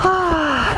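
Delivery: high, falling "ah"; settling sigh. Acted. Princess lying down to sleep, high falling sigh. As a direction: sigh out as you lie back.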